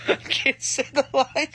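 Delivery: breaking into laughter mid-sentence; laughing. Real, not acted. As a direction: giggle while admitting defeat. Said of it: "I can't say it".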